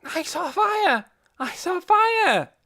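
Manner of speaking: put-on high, slow, loud child shouting; a little girl calling out what she saw; excited child. A narrator's reading. Little Poppy yells the news to everyone, excited.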